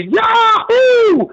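high, loud party yell; drunken whoop. Retold or imitated. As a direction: whoop it up like a tipsy tailgater. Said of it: "had too much to drink and said".

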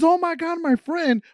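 high, mock-distressed girl voice; imitating upset girl. Angry girl finding her friend in the car, high mock dismay. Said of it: "She got super mad, right? She goes".

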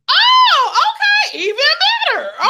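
high, loud swoon of approval; delighted approval. Retold or imitated. Woman hears he's from London, delighted approval. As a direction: squeal it, thrilled by the news. Retells delighted reply to his accent.

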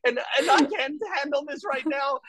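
high, exasperated mom voice; mock panic over a ruined dress; frazzled mom. Mom covered in baby spit-up, exasperated high voice. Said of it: "She's like".

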